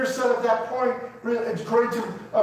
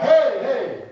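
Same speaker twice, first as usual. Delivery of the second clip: higher, casual call for attention; mock calling out; 4 semitones higher, 4 dB louder than usual. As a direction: call out lightly, as a mere shout. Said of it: "he cried out".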